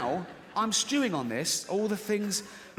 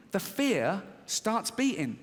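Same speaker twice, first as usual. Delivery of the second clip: normal talking voice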